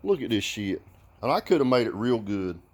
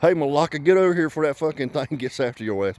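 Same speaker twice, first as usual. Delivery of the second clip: raised, quick holler at a buddy; mock Greek guy talk; 6 semitones higher, 3 dB louder, faster than usual. Showing how Greeks really talk, quick loud warning to a pal. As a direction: call out urgently as a regular Greek guy. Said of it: "No, it'd be something like".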